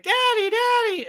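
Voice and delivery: put-on high excited child calling; little kid voice. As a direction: squeal it like a small kid. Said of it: "And they're like".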